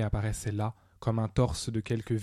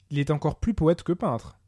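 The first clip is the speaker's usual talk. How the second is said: plain reading voice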